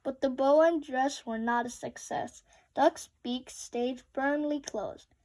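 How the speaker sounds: ordinary delivery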